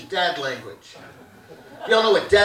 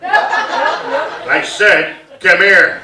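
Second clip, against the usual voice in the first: imitating a curt dad's summons; mock fatherly order, with pauses; commanding dad; 5 dB louder, much slower than usual. Dad summons kid from his chair, commanding.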